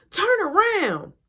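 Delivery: high, slow warning call. Retold or imitated. Brother warning about the dog.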